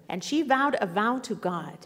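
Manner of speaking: normal talking voice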